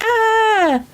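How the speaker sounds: sudden high squeal. Real, not acted. Squeal at pregnancy news.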